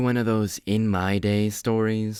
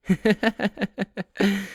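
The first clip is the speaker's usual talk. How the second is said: mocking laugh; 9 semitones higher than usual. Mocking a rival's nickname.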